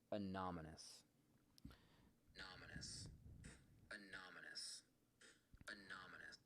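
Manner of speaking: faint reversed whisper; backwards speech. Shown as a demonstration. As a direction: sound like a recording played backwards. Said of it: "if you say anonymous and play it backwards".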